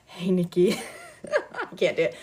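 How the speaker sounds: trying the line through real laughter; giggling. Real, not acted. Reader unable to manage the whisper, giggling. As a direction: give up, laughing.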